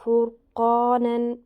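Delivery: فرقانن stretched very slowly, with a heavy ر; slow Quran recitation. Shown as a demonstration. Teacher reciting the example to students, slow recitation. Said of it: "اس سے پہلے پیش ہو".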